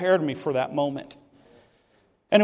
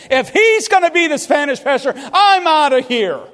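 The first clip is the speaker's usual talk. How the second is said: much higher and louder, huffy, with a laugh; indignant walkout; 9 semitones higher, 7 dB louder than usual. Retold or imitated. Preacher voicing a member quitting over the new pastor, indignant. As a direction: storm out in mock outrage. Churchgoer walks out.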